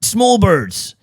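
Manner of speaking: high, silly rural farmer voice; hammed-up country farmer. Voicing Bernard Matthews breeding turkeys.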